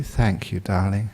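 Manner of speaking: soft, low, tender thanks; gentle acted gratitude; sweet. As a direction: murmur it sweetly as the reconciled wife. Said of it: "smiled sweetly".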